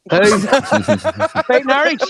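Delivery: drawn out through a laugh. Real, not acted. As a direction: laugh the name out slowly. Laughing at the chat name.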